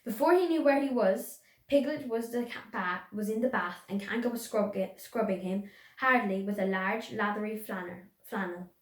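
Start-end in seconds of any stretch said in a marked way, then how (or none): none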